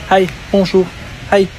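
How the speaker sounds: high, bright mimicked hello to passersby; lightly imitating chirpy strangers saying hi; mock greeting. Mocking the strangers who keep greeting her, in a high singsong. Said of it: "I do not understand this hi".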